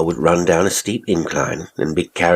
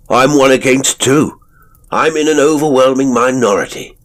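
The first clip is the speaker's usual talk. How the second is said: boisterous; 9 semitones higher, 7 dB louder than usual. A narrator's reading. Jovial self-mocking after laughing.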